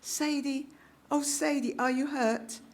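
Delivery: put-on an old woman anxiously calling a fallen girl. A narrator's reading. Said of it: "the cat lady asked anxiously".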